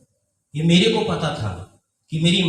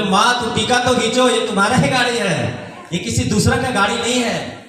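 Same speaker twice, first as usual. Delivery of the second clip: laughing reassurance; 6 semitones higher than usual. Retold or imitated. Son assures mother, laughing.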